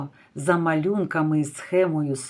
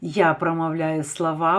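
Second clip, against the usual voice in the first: usual speaking voice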